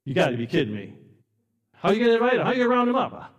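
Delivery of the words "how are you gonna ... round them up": incredulous neighbor. Acted. Neighbor challenges Noah in disbelief.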